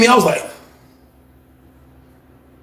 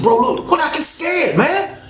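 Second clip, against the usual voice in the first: higher, pressing tone; high urging voice; 10 semitones higher, 3 dB quieter than usual. Retold or imitated. Urging the cellmate to stand up to Frank, high pressing voice. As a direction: push him to toughen up, pitched high. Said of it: "I said".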